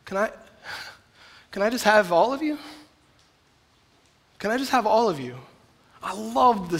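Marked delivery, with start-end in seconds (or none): 0.0-5.4 soft, tender invitation; Jesus softly asking for all of you, tender and quiet; gentle Jesus. Acted. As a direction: coax gently, almost hushed. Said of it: "And he's like".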